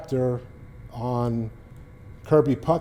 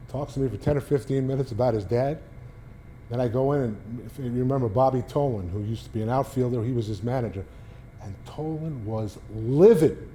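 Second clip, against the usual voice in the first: ordinary talk, nothing marked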